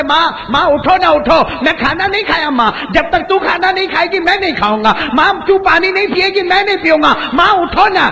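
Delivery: high, pleading coaxing voice; pleading son. Acted. Companion coaxing his old mother to wake and eat, pleading. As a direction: beg her tenderly to wake up.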